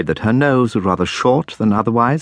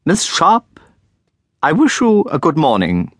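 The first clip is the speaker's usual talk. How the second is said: put-on much higher, stiff and haughty old headmistress; haughty Miss Pinkerton; 9 semitones higher, 3 dB louder than usual. A narrator's reading. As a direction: look down your nose at the pupil. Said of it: "she said".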